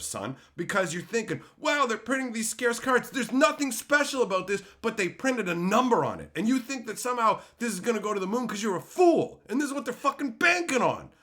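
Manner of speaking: high, loud rant building to a shriek; retold yelling. Streamer reenacting yelling at his friend in the mall. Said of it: "I was yelling".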